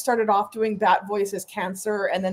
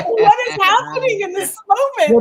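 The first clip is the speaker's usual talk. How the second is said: high, loud exaggerated bewilderment; mock incredulous outburst; 11 semitones higher, 8 dB louder than usual. Host reacting to a film's diner scene, loud mock bafflement. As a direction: wail the question high and loud in disbelief.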